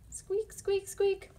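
high, tiny squeaky mouse voice; high-pitched mouse squeaks. Acted. Mouse sound in picture book.

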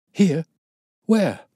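sharp, high, loud one-word questions; startled and incredulous; amazed. A narrator's reading. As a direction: blurt it out in disbelief. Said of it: "stared at him in amazement".

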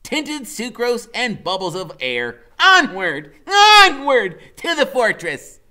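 very high, loud mock-archaic; rally the steed onward; Freak's knightly voice. A narrator's reading. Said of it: "Freak goes".

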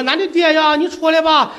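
high Shandong-Henan country accent, calling out; quick sketch of a Shandong-Henan rural call. Shown as a demonstration. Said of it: "多少带一点口音".

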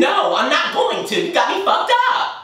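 angry refusal. Real, not acted. Refusing a friend who wants them closer, indignant. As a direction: refuse outright, voice shooting up. Quarrel over being touched.

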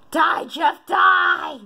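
high, loud mock death threat; playful battle cry. Quiz taker pretends to attack Jeff, playful shout.

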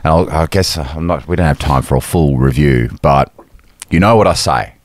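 usual speaking voice

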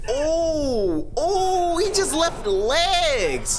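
shocked outburst. Real, not acted. Shocked by realistic creepy clip.

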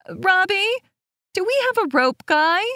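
high, fast call to another room; calling over shoulder. A narrator's reading. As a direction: call out across the house. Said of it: "calling over her shoulder".

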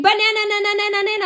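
high, loud banana chant; playful screaming. Pretend. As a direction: rattle it off like a silly siren. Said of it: "start screaming".

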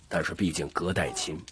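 ordinary delivery, nothing marked

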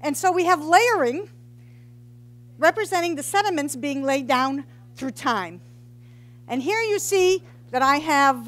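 usual speaking voice

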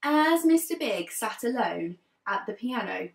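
put-on lively read-aloud for young children; teacher reading a picture book to her class; storybook narration. A narrator's reading. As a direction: tell it like a class story time.

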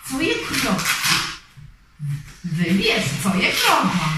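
ordinary delivery

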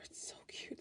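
hushed whisper, melting; whispered gush. Real, not acted. Gushing to viewers over penguin lovers card, whispered. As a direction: whisper it, overcome by cuteness.

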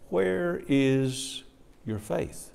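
soft, slow, fading question; quiet question trailing off to a near whisper; gentle Jesus. Acted. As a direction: ask it tenderly once the storm is calm. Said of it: "then he says".

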